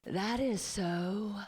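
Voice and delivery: slow, drawn-out awed sigh of beautiful. Retold or imitated. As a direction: melt over the name as if it were precious. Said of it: "the way they say beautiful".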